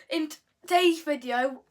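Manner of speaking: ordinary delivery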